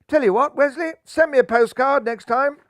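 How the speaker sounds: loud, high mocking call. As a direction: shout the jibe down the pitch. Skipper calls out to bowler.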